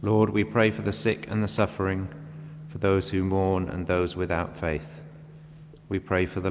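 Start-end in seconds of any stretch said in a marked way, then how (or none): none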